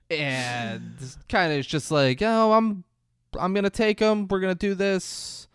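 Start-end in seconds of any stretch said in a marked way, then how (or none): 2.2-5.5 high-pitched, breezy put-on voice; Gil's voice. Gil telling Bobby he's taking the kid, breezy. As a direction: imitate a man airily insisting on his plan. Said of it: "as Gil's doing that voice".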